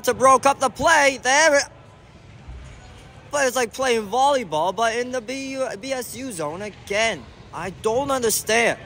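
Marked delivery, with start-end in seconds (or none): none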